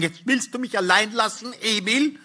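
high-pitched, pleading cry; wife's plaintive call. A narrator's reading. Wife stopping her husband from leaving, high plaintive call. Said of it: "rief sie".

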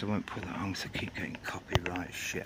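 fast whispered aside; hushed, quick confession. Real, not acted. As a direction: slip it in under your breath, quickly. Aside about copyright.

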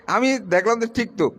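ordinary talk, nothing marked

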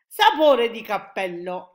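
loud, drawn-out outburst; exclamation. A narrator's reading. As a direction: burst out loudly, stretching the words. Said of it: "esclamò il G G G". (esclamazione forte e allungata)

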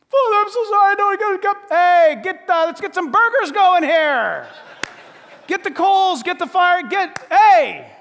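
loud, high, laughing orders for the barbecue; the father yelling happily at servants; gleeful father calling for a feast; excited shouting. Acted. Father ordering feast over confession.

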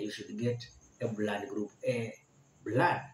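ordinary delivery

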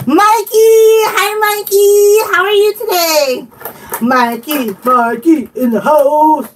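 real excited, stretched-out welcome; excited greeting. Real, not acted. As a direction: call out to a friend in giddy singsong. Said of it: "just a little excited".

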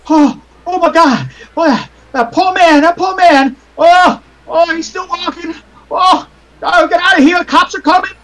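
high, loud frightened shrieks; panicked crowd screaming. Acted. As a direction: shriek in terror at the fallen man. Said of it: "you hear people screaming".

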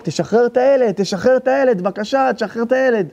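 צעקת תחינה גבוהה בקול האישה; לחקות אישה צועקת בהיסטריה; צעקה מחוקה של האישה. Retold or imitated. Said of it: "ואשתי מתחילה לצעוק". (צעקת תחינה גבוהה, כמו אישה היסטרית)

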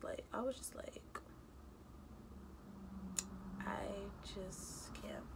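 whispered, defeated. Real, not acted. Telling viewers how stressed she was, whispered. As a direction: breathe it out as a whisper.

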